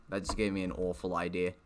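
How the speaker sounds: ordinary talk, nothing marked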